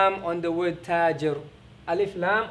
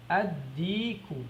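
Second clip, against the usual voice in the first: "Ad-diku" stretched out slowly, the d doubled; slow teacher repetition of "Ad-diku"; slow demo; 4 dB quieter, much slower than usual. Shown as a demonstration. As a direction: model "Ad-diku" very slowly for learners. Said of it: "How do you say the rooster?".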